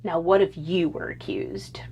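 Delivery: hushed, breathy question; whisper. Real, not acted. A whisper to the young men about being accused. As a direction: lean in and whisper it.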